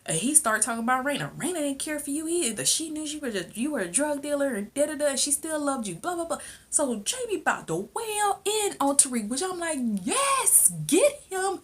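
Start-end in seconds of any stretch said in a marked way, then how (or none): 1.4-6.4 high-pitched mock retort; retold protesting son. Son taunts father about sister, high protesting imitation. Acted son's retort continues. 10.0-11.6 high-pitched cheer that slides down; excited egging on. Retold or imitated. Viewer eggs father on against son, high cheering.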